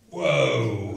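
sudden loud low whoa; startled whoa from the crowd; exclamation of surprise. Real, not acted. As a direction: react as if struck by it. Reaction to the statement.

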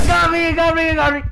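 startled scream. Real, not acted. Caught by monster in game.

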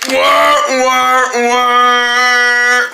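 hammed-up baby wail; mock crying. Little doll crying after a fall, a mock wail. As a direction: wail like a toddler faking tears.

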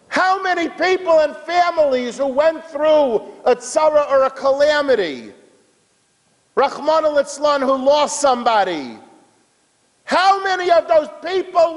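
high, sustained rhetorical questioning; impassioned preaching. Real, not acted. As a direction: cry out the questions to the congregation. Preaches the lesson with fervor.

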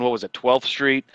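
ordinary delivery

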